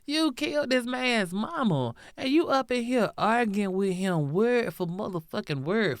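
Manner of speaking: usual speaking voice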